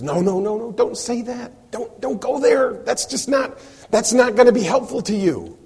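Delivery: high, anxious pleading; pleading protest. Retold or imitated. Crowd begging Jesus not to say it, high pleading.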